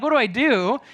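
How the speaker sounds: ordinary delivery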